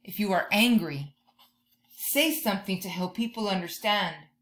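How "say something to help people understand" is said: usual speaking voice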